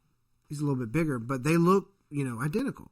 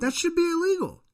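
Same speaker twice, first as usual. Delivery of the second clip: much higher and louder, real indignation; indignant outburst; 6 dB louder than usual. Real, not acted. Reacting to his cohost's shrug, a high indignant outburst. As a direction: blurt it out, truly appalled.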